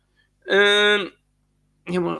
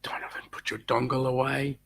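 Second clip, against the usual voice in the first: whisper; 9 dB quieter than usual. Acted. Teasing aside to co-host.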